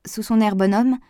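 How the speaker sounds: plain reading voice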